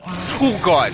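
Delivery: high, loud jump scare cry; startled yelp. Real, not acted. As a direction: blurt it out, genuinely spooked. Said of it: "you scared the shit out of me".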